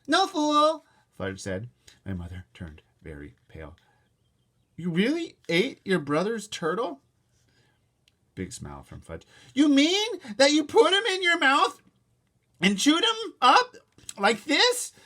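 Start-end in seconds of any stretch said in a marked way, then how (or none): 0.1-0.8 put-on high, loud kid voice; blunt toddler denial. Toddler answering his mother, kid voice. 4.8-14.9 high, loud, slow horrified questions; alarmed mother. Acted. Mother questioning her toddler, horrified.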